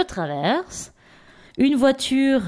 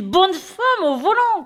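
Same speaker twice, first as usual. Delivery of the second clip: mock sexist jab; 9 semitones higher, slower than usual. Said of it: "Je suis désolée, il fallait que je la fasse".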